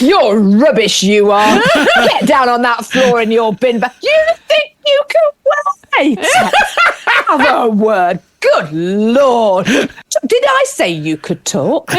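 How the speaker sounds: slow, scornful domme orders; harsh barking commands. Pretend. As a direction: bark orders at a grovelling sub. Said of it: "I do love a little bit of acting".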